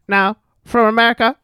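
high-pitched Scottish golf announcer call; Ivor Robeson impression. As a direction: announce a golfer in a piping, halting Scottish voice. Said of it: "Ivor Robeson impersonation".

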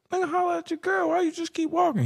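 high-pitched incredulous questioning; retold disbelief. Friend questioning homeboy who let a man holler at his wife, incredulous.

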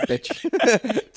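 high loud mocking bark; Tiger Woods impression. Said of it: "Tiger Woods saying bitch".

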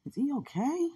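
high, breathy worried questions; worried concern. Retold or imitated. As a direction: voice the old anxious puzzlement. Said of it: "I was like".

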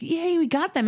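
high-pitched little cheer; retold yay, pitched well up; mock celebration. Mother retells getting the Lego gifts, lightly hammed-up excitement.

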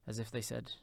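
usual speaking voice

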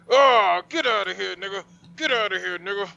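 high-pitched mock yell; hollered retelling of charging an intruder; dream confrontation.

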